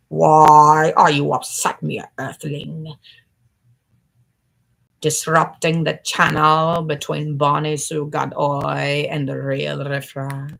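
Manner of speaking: slow alien speaking to an earthling. Acted. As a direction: loom over a mere earthling. Alien riffraff character voice.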